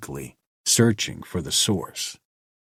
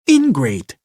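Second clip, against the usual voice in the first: loud, high biting snap at him; sharp snap; 8 semitones higher, 7 dB louder than usual. A narrator's reading. Mara snapping at ungrateful Asir, loud sharp snap. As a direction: spit the word out. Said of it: "she snapped".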